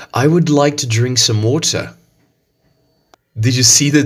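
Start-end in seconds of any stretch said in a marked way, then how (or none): 0.1-1.9 British English, water without the r; British accent. Shown as a demonstration. Teacher repeats the sentence in a British accent. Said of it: "the phrase using the British accent".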